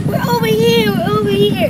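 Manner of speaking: high, loud shout for help; calling out. Acted. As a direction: yell to be spotted. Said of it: "Mom was jumping up and saying".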